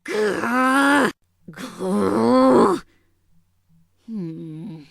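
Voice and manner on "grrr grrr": rising, loud frustrated growl; angry growl. Acted. Lena fuming at Naga's taunts, angry growl. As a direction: growl with mounting irritation.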